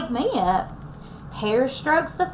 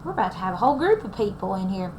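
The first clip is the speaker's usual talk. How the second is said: ordinary delivery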